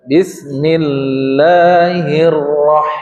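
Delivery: Bismillahirrah- with the doubled r pressed, very slowly. Shown as a demonstration. Teacher demonstrates the tasdid on ra to the class, very slowly. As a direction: drag out Bismillahirrah- and lean on the doubled r. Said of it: "sudah tekanan tuh".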